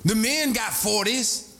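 high, insistent mock lady voice; eager female recruits. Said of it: "That's what the ladies say".

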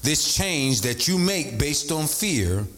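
slow dictation; slow restatement. Shown as a demonstration. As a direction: spell it out slowly, word by word. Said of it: "Let me say it again".

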